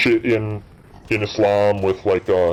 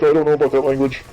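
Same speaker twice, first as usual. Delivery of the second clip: mock prude; 6 semitones higher, 3 dB louder, faster than usual. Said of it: "People would say, like".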